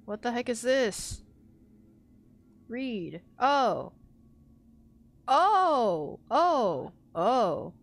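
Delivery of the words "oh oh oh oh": loud startled gasps. Real, not acted. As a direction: blurt oh in surprise. Startled repeated exclamations.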